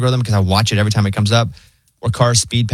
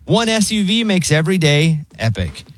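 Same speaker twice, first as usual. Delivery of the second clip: higher-pitched, measured movie-trailer style car pitch; radio ad read; 5 semitones higher than usual. Acted. Host pitching a hybrid SUV to listeners, polished ad read. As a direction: sell it in neat, separated phrases.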